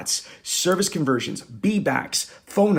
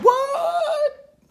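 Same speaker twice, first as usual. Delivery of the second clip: mock falsetto squeal, much higher; shocked squeal. Offended manager squealing back at speaker in mock falsetto. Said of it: "He said".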